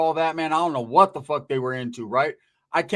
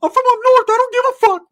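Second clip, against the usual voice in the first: high, loud squeaky tough-guy yell; tough guy challenge; 9 dB louder than usual. Retold or imitated. Teller mocking Ricky mouthing off at the locals, loud high squeaky challenge. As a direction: squawk it out like a wannabe hard kid. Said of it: "He's talked like that all the time".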